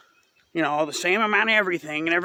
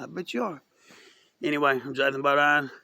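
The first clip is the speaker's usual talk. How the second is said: ordinary delivery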